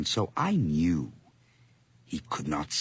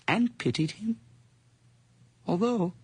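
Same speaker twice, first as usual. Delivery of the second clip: manic lilt; 13 semitones higher than usual. Acted. Narrator's mad pity and glee.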